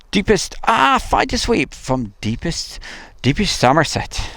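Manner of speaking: high-pitched, hammed-up attempt at a Somerset accent; bad Somerset accent. Streamer hails the fighter sweep from Somerset, bad Somerset accent. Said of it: "that was not an accent from Somerset".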